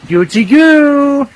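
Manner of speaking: high, drawn-out coochy-coo; tickling coo. Acted. Joker tickling with a pun on a fashion brand, drawn-out tickling coo. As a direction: stretch it out high, as if tickling someone. Tickling baby-talk punchline.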